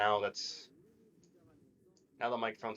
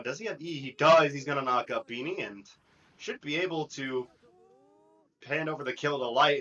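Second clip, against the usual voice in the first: loud, higher caster call; excited play-by-play; 6 semitones higher, 8 dB louder than usual. Acted. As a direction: call the fight live and hyped.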